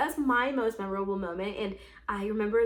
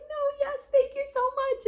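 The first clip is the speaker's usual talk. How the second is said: much higher, tearful-happy thanks; teary thank-you. Retold or imitated. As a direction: squeak it out through happy tears. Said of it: "I was just bawling my eyes out".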